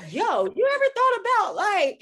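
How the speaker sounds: hammed-up high, loud fan quote; excited fans. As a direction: mimic eager fans egging her on. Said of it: "they're like".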